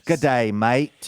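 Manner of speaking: slow put-on Australian accent. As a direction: drawl it in a mock Australian accent. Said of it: "how passable is my Australian accent".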